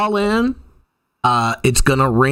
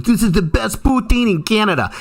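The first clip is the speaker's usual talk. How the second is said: raised pitch, proud retold boast; enthusiastic local guide; 7 semitones higher than usual. Local guide showing a visitor his favorite poutine, enthusiastic. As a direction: imitate an eager local selling the food. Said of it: "He goes".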